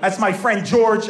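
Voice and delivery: ordinary talk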